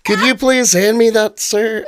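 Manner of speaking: high-pitched, mock-polite plea; sheepish request. Acted awkward polite request.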